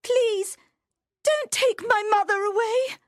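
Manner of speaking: crying out. Acted. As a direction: cry out for her mother. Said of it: "cried Rhiannon".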